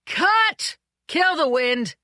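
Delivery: loud, high shout across the set; a director yelling over the wind. A narrator's reading. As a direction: bellow the order to cut.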